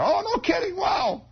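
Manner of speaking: put-on high excited boy's cry; gleeful kid shout. Replays kid's gleeful cry.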